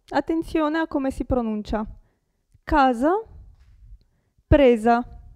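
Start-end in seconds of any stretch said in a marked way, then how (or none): 2.6-3.1 casa with a voiced s, kaza. Shown as a demonstration. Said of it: "S tra due vocali". 4.5-5.2 presa with a voiced s, preza. Shown as a demonstration. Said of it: "S tra due vocali".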